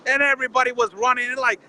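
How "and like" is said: normal talking voice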